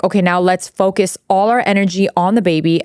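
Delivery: ordinary talk, nothing marked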